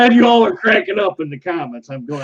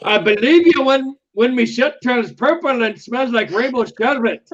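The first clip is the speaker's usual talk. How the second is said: a lightly put-on Irish accent, a bit higher; 5 semitones higher than usual. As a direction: read the movie line in a loose Irish accent. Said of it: "my interpretation of an Irish accent".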